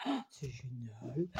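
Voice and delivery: low, slow, very soft marvelling; hushed delight. Real, not acted. Vlogger admiring a friend's envelope, hushed delight. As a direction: drop into a soft, drawn-out murmur of wonder.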